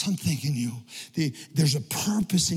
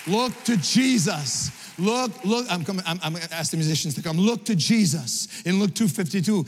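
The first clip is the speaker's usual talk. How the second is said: loud, raised preaching over music, pushing hard; preaching at full force; 3 semitones higher, 3 dB louder than usual. Acted. Pastor preaching to the congregation over music, loud and fervent. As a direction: build the climax and drive every line home.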